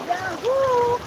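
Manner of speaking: high, whooping cheer; excited whoop. Real, not acted. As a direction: whoop out with joy. Cheering in the river.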